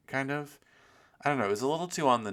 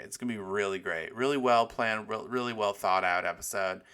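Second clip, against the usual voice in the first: ordinary talk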